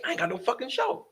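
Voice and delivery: retold panic, squeaked high; panicked. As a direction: imitate freaking out, high-pitched. Host panicking over cancelled show.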